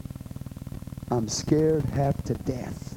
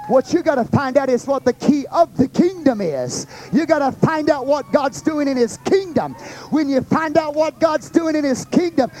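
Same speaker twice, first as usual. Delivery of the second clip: rising preacher's voice, louder and higher; full preaching; 9 semitones higher, 3 dB louder than usual. Acted. Preacher to congregation, rising and louder. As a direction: build the sermon to a peak.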